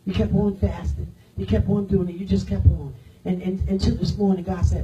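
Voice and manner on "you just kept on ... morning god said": normal talking voice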